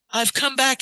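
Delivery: lifted, declaiming quote of Euripides; reading Euripides aloud. Retold or imitated. Reading Euripides to the listeners, mildly declaiming. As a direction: read the tragic line a little grandly. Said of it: "Just listen".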